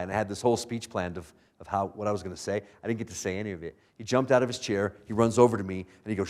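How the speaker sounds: normal talking voice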